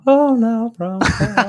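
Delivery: "How now, brown cow" squeaked very high and loud; overdone posh diction drill on "How now, brown cow"; high hammed elocution. Shown as a demonstration. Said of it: "You gotta do".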